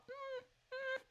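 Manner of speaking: high soft whimpering hum; a small frightened whine; mock whimper. Celine whimpering in scene.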